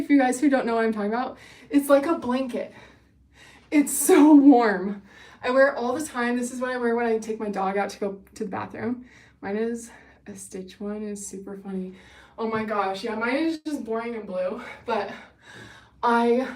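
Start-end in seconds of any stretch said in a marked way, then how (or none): none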